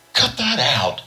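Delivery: high, loud protest; angry cry. A narrator's reading. Lil, slapped by George, cries out high and loud. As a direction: cry out in shock and anger. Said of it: "she cried".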